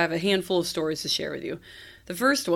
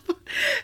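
high giggle. Real, not acted. Laughing at the bidet mess.

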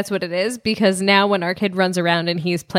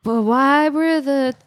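confused musing; 5 semitones higher, much slower than usual. Retold or imitated. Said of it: "I was like".

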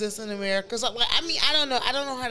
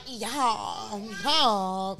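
mimicking Kim; 4 dB quieter than usual. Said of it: "The bitch always talk like".

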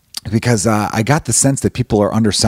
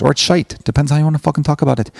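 higher-pitched, badly faked Irish-British accent; 5 semitones higher than usual. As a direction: ham up a dodgy British Isles accent. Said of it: "I'm gonna stop doing bad accents".

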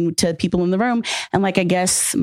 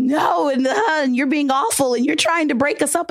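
dramatic accusation; 7 semitones higher than usual. Retold or imitated. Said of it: "And she dramatic".

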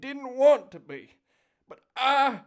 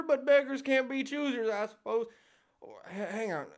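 normal talking voice

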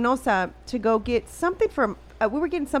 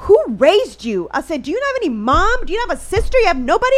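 high, loud mock scolding; indignant scolding; 7 semitones higher, 6 dB louder than usual. Retelling scolding officer who left luggage out, indignant. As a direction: scold them in disbelief, pitched way up.

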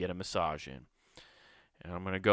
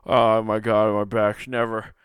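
overdone pleasure moans in the chair; mock massage moaning; 6 dB louder than usual. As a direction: ham up someone melting under a massage. Mimicking massaged person's moans.

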